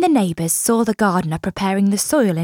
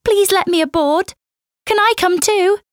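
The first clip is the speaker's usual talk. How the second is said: put-on much higher, begging child's voice; pleading little boy; 10 semitones higher than usual. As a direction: beg to be let aboard. Boy begs to join crew.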